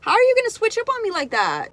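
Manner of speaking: high, loud betrayed outburst; outraged disbelief. Real, not acted. Friend telling how her best friend betrayed her, outraged. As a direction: shoot the pitch up in shocked anger. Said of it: "I'm pissed".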